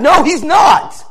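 loud, high, insistent retelling; impassioned protest. Retold or imitated. Dad refuses to let the boy die in prison, loud impassioned protest. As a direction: argue back at full preaching force. Father argues for the dying boy.

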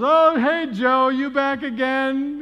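loud, high, slow greeting; hearty shout. Retold or imitated. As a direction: holler hello to a familiar regular. Shop worker greets the regular.

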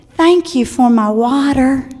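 put-on sweetly earnest child thanking the waitress; imitated little boy. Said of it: "And he goes".

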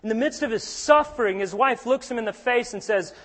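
ordinary talk, nothing marked

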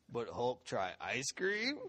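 low, slow monster voice rising hopefully at the end; comic brute, drawn out; Hulk. Retold or imitated. Boy agreeing to try dessert in Hulk voice. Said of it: "say things in this really hilarious Hulk voice".

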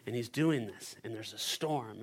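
ordinary talk, nothing marked

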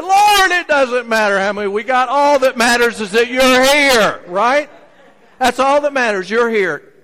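loud, high, ringing declaration; loud fervent declaration. Acted. Disciples answering Jesus, loud fervent declaration. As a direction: proclaim it with everything you have.

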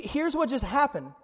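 ordinary talk

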